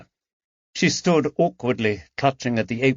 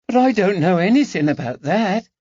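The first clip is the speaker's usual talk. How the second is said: put-on high, anxious girl's denial; nervous young maid protesting; a touch of alarm; 10 semitones higher, 4 dB louder than usual. A narrator's reading. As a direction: maid startled by talk of a murder reward. Harriet frightened by murder talk.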